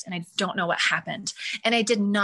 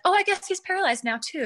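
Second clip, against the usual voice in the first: nonchalant doctor; 10 semitones higher than usual. Retold or imitated. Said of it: "they just said it so nonchalantly".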